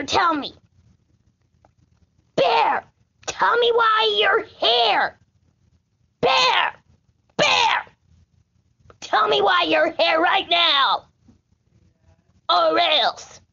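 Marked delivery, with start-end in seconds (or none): 2.4-11.1 high, insistent calls at the bear; calling at the bear. Pretend. Demanding the bear explain why it's here, high calls.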